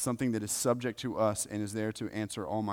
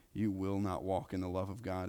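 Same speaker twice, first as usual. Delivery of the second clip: ordinary talk, nothing marked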